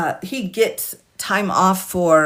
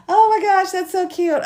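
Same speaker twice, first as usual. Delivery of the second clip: real high-pitched squeal of delight; delighted gushing; 12 semitones higher, 4 dB louder than usual. Real, not acted. Streamer gushing at viewers' corgis, high delighted squeal. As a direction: squeal it, melting at something cute.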